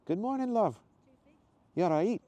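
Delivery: high-pitched Scottish woman; lilting Scottish greeting, pitched well up; friendly woman with a Scottish accent; soft Scottish accent. Acted. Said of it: "much softer Scottish accent".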